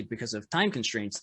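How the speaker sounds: ordinary delivery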